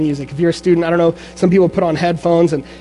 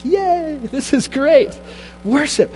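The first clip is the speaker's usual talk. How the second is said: put-on much higher, bursting with childlike joy; giddy excitement; 9 semitones higher, slower than usual. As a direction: squeal it like a kid who just found his favourite thing. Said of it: "I was like".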